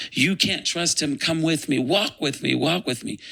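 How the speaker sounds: high, insistent plea easing down at the end; urgent coaxing. Retold or imitated. Lucifer warning Michael against Jesus, urgently pleading. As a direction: beg them to follow you.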